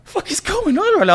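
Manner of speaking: high, baffled squeak of disbelief; imitating his own shocked confusion; retold disbelief.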